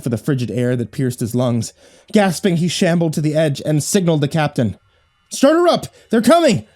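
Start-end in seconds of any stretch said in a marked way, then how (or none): 5.3-6.6 shouted in raw panic; panicked shout. A narrator's reading. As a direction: yell it, terrified. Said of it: "the raw panic in Thomas's voice".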